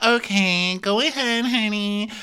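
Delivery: very high, sing-out put-on voice; Victoria. Waving Amel off as she leaves, high mock sweetness. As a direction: send her off in a high mock coo. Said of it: "Victoria's like".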